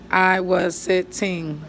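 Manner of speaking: "I was sitting" stretched slowly, word by word; slow drawn-out rereading. Shown as a demonstration. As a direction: reread it slowly while checking the count. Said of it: "I have to fix it".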